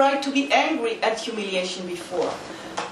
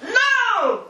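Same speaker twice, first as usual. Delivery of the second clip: high-pitched defiant refusal; acted anger; 9 semitones higher than usual. Samurai refuses being put down, acted anger. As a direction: play an angry, high "no". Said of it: "I get angry".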